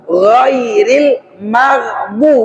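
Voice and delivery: "Ghairil maghbu" drawn out with a clear gh; slow correct reading. Shown as a demonstration. Teacher modelling the right reading for students, slow. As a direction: read "maghbu" slowly, voicing the gh. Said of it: "bukan mau".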